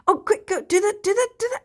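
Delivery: high, rushed mock urging; urgent egging on. Friends on a delay urging her to act, high and rushed. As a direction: imitate friends urging you on, pitched high. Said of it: "So they'd be going".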